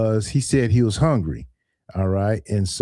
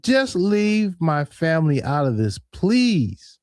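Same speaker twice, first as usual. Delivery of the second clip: mock pleading; 7 semitones higher, slower than usual. Pleading with threatening scammer.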